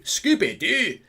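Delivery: playful cartoon-dog impression; Scooby-Doo. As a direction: call out the name like the cartoon dog. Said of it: "Scotland were Scooby-Doo".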